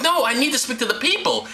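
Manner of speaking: high, hammed-up demand; mock insistence. Killer being led off to guards, mock insistent. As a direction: insist like a pleading prisoner.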